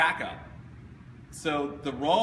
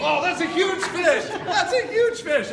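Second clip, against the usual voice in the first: much higher, louder, slow mock astonishment; amazed delight; 12 semitones higher, 8 dB louder, slower than usual. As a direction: marvel at the kids' fish, laughing. Kids show fish sizes, presenter marvels.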